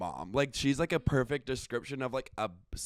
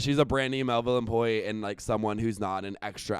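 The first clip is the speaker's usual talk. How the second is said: ordinary delivery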